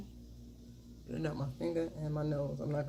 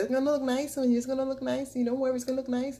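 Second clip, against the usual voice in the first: imitating store clerk; 8 semitones higher, 4 dB louder, faster than usual. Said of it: "the man in the store was like".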